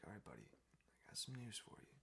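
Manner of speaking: hushed, near-whispered parent; gentle bad news. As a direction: imitate a mother softly preparing her child. Mom gently breaks bad news.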